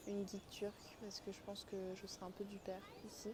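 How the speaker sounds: softly murmured commentary; hushed. Real, not acted. Vlogger confiding inside a mosque, hushed. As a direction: speak very quietly, not to disturb worship.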